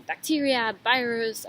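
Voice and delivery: normal talking voice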